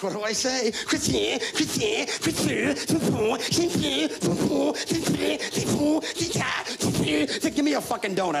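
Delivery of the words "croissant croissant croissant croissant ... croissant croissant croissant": high, rapid mock-French "croissant" over and over. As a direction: spit out "croissant" in silly French sounds.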